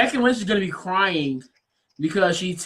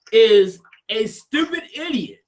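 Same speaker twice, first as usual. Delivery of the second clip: ordinary talk, nothing marked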